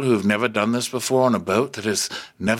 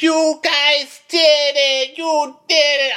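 high, loud, slow gushing cheer; mock cheering captain; 6 dB louder than usual. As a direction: cheer them on like an overexcited coach. Captain cheering after the slide.

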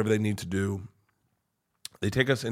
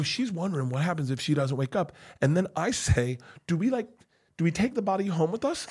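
high-pitched, panicky questioning; retold rising panic; melting down; 6 semitones higher, 3 dB quieter than usual. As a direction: ask it as a parent starting to lose it. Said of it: "I'm melting down".

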